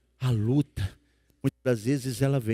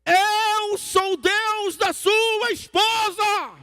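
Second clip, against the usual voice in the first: booming-slow, very high-pitched thunderous shout; shouted divine voice; 4 dB louder, much slower than usual. Retold or imitated. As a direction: roar it out, stretching every word. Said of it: "essa voz gritou assim". (voz divina gritando, lenta e aguda)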